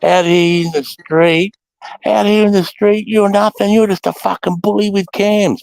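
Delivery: high, drawn-out jeering wail; mock drunken taunt. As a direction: mimic a cocky drunk calling someone out. Acts out drunk recruit's taunt.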